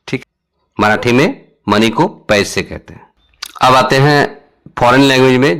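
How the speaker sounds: ordinary talk, nothing marked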